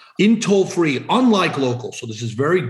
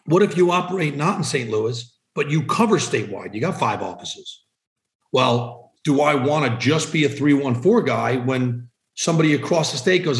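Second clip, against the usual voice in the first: ordinary talk, nothing marked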